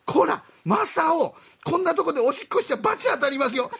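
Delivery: high, sharp mom scolding; scolding mother. Acted. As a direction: tell off a naughty son. Mother scolds her son.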